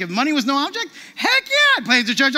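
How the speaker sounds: wife bursting with eager enthusiasm; thrilled shout of agreement; excited yes. Retold or imitated. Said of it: "She was like".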